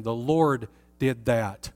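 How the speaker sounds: ordinary talk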